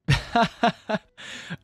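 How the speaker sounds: high, bright burst of real laughter. Real, not acted. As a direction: crack up at the goofy skin. Laughing at ridiculous Graves skins.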